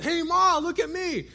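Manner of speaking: high, loud shout across the pool; yelled call. Retold or imitated. Girl in the pool yelling to impress mom and grandpa. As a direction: yell proudly to get mom's attention. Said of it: "I yelled".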